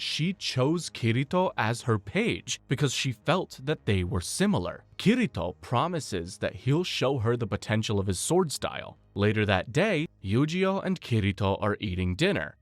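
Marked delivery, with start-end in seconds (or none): none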